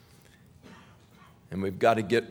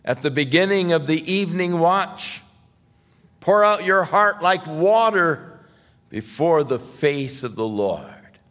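fervent preaching; 7 semitones higher, 6 dB louder than usual. Acted. Preacher voicing Jeremiah to the mothers, loud and pleading. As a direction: call the mothers to weep and pray.

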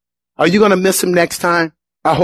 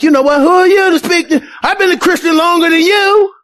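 indignant believer; 10 semitones higher than usual. Retold or imitated. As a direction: bristle at being corrected. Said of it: "we're like".

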